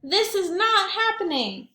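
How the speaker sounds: high, indignant protest; protesting boy. Acted. Reginald protesting against Edwina, high indignant voice. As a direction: stamp it out like a frustrated kid. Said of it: "Reginald tried protesting".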